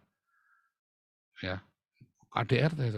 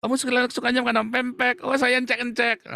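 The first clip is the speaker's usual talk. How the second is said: giddy couple; 8 semitones higher than usual. Retold or imitated. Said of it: "Anda sama dia".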